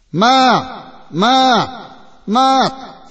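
high, loud call across the house; a boy shouting for his cat, high and loud. Acted. Boy calling the cat loudly.

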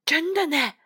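ordinary delivery

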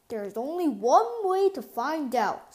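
proud dad pointing out the beach house; Papa Bear. A narrator's reading. Papa Bear shows family the beach house, lightly voiced papa.

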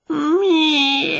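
high, drawn-out cat meow; miserable cat wail. A narrator's reading. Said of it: "he wailed miserably".

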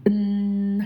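"嗯" hummed far back in the mouth; back nasal ng. Shown as a demonstration. Teacher repeating the second sound, sustained back nasal. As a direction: hold "嗯" as a long ng. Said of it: "它的发音是往嘴巴里面往后走的".

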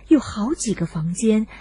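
ordinary delivery

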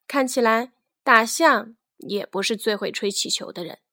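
plain reading voice

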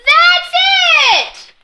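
high, loud cheer; yelled. Acted. Whole class yelling in cheer, loud and high. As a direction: cheer him on all at once. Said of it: "everyone yelled".

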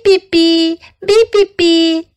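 put-on high sung beeps like baby birds; chirping goslings. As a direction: peep it out like seven tiny goslings. Goslings answer roll call.

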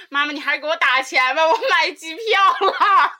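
lightly acted plea, stretching the last words; wheedling call to mum. Daughter asking mum for money yet another time, lightly wheedling.

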